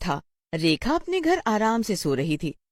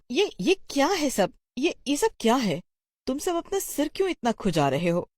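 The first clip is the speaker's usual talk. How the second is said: perplexed teacher; 8 semitones higher than usual. Acted. Teacher questions scratching class.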